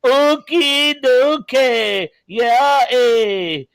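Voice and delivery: high, hammed-up French Canadian accent, slow on okie dokie; mock French Canadian accent. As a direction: tease him with a cartoon French Canadian accent. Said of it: "with this French Canadian accent".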